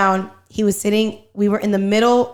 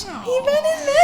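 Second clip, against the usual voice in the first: mock crying; slower than usual. Real, not acted. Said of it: "Voy a llorar, no mames".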